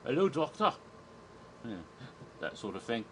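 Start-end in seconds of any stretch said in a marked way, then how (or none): 0.0-0.7 imitated Scottish accent, pitched up. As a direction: greet in a lightly put-on Scottish accent. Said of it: "a Scottish accent".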